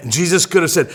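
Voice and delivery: ordinary delivery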